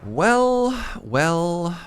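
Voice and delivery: high, loud drawn-out well; villain gloating. Pretend. Mock triumphant drawn out.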